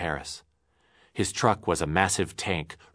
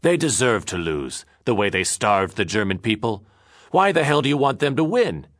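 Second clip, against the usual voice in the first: louder, rising to a high angry demand; proud defiance; 5 semitones higher, 5 dB louder than usual. Acted. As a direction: puff up and defend it. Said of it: "suddenly he felt proud".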